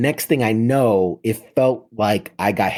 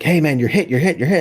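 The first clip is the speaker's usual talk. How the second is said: urgent call; 6 semitones higher, 3 dB louder, faster than usual. Retold or imitated. Medic calls out he's hit.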